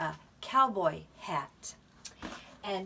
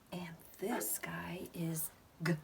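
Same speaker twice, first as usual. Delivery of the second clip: whisper; 3 semitones lower, 9 dB quieter than usual. Pretend. Secret whisper before reveal.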